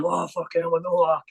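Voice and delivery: a mate bursting with excitement; buzzing. Retold or imitated. Mo out of the cells, retold as an elated high outburst. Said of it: "Mo buzzing".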